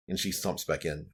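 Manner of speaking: ordinary talk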